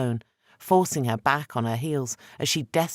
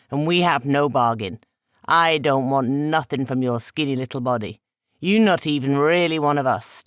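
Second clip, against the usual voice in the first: put-on louder, scornful accented spirit; scornful trickster god; 6 dB louder than usual. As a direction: dismiss him with cold contempt. Eshu scornful speech.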